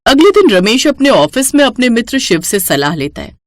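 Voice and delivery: plain reading voice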